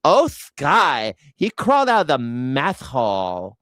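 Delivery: a put-on lisping voice, "meth hall" said for mess hall; mock someone who says "meth hall" in place of "mess hall"; "meth hall" lisped with a put-on speech impediment; speech impediment impression. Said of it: "probably talks like this".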